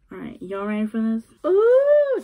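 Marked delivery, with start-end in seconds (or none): 1.5-2.2 high, loud teasing ooh; mock excited ooh. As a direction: fake thrill, then take it back. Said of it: "just kidding".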